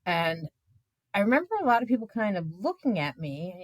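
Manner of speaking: ordinary delivery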